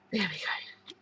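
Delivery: relieved whisper to herself. Real, not acted. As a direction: breathe it out once it opens. Lid finally comes off.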